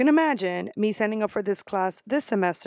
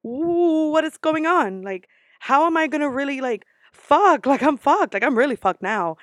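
higher, louder freaked-out outburst; mock panic; 6 semitones higher, 6 dB louder than usual. Student reacting to a professor's thick accent, mock panic. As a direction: play up being overwhelmed, rising into loud exclamations.

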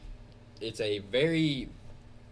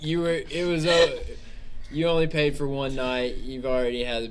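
much louder, exasperated scolding; fed-up clerk; 4 semitones higher, 10 dB louder than usual. Acted. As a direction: throw the guests out, done with them. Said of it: "so fed up".

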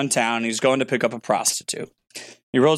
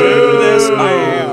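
slow, loud, higher jeering chant; drawn-out mock boo; 7 semitones higher, 9 dB louder, much slower than usual. Booing a friend's bad joke with a slow loud jeer. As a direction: stretch it out like a stadium heckle.

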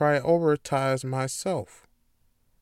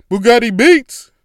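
much louder, higher beat-tag yell; producer tag shout; 8 semitones higher, 14 dB louder than usual. Shown as a demonstration. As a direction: shout it like a rap intro drop. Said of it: "say Bugatti Beats like that".

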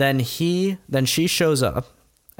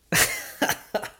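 unvoiced laugh; 6 dB quieter than usual. Real, not acted. Laughs at own spoiler.

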